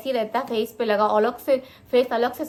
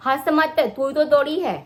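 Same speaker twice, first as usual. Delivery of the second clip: higher, louder telling-off; real scolding; 6 semitones higher, 7 dB louder than usual. Real, not acted. Mother telling off the laughing child, scolding. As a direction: snap back loudly, pitch raised.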